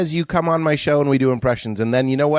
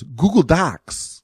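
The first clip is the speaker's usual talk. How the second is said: slow, stretched Walken impression; Christopher Walken; 2 semitones higher, much slower than usual. Comic quoting a friend's bit, drawn-out Walken. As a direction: drag both words out like Walken. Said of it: "do Christopher Walken saying one thing".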